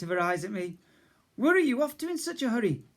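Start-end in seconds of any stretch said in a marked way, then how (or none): 1.4-2.8 much higher, quick fussing voice; motherly cook. Acted. Cook asks the rushing boy, high and quick. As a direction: fuss over him like a gran.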